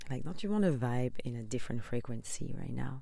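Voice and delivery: ordinary talk, nothing marked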